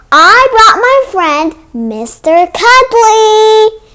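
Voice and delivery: loud, eager storytime host voice; reading to children. Acted. Teacher opening storytime for young kids, loud and eager.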